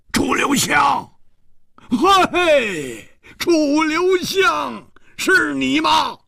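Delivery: high, stretched calls across the room; joyful calling out. Acted. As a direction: cry out in happy disbelief. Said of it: "失声呼道".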